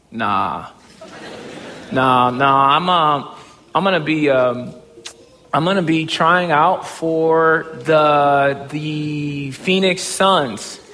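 slow, halting, low-voiced admission; hesitant, dragged-out reluctant reply; sheepish confession. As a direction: stall and stretch each word before confessing. Sheepishly confessing to his boss.

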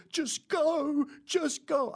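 high, thin, trembling plea; imitating a scared woman; mock frightened. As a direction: shoo him away as if terrified. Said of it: "she starts shaking".

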